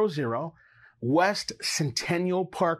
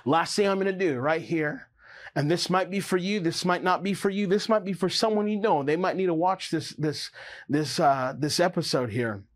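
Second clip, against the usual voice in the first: usual speaking voice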